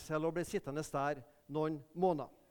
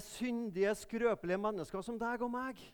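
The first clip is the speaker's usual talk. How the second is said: normal talking voice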